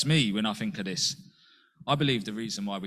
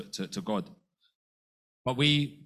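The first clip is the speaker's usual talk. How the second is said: ordinary talk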